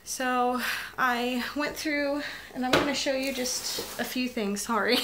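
real choked-up voice, pitched high; high, tearful voice, trying to carry on; getting emotional. Real, not acted. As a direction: fight back tears as the voice climbs. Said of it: "I'm sorry I'm getting emotional".

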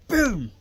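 one sudden blasting boom; explosive punch sound; shouted boom. Shown as a demonstration. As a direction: slam the word out like a punch landing.

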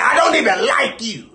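high yelled retort; mocking high shout. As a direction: fling it back like an insult. Retold rude customer quote.

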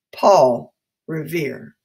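stretched slow reveal; slow, drawn out. Shown as a demonstration. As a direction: draw the name out slowly. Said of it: "Who is Samuel Adams taking them to meet?".

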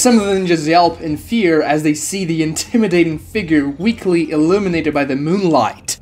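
plain reading voice

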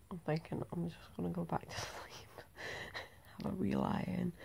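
soft unvoiced whisper, just woken. Real, not acted. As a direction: keep it hushed, early morning. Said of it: "if I'm whispering".

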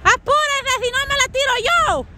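high-pitched hurrying shout; impatient call. Real, not acted. Waiting watcher to sledder, threatening to go instead, impatient shout.

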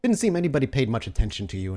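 usual speaking voice

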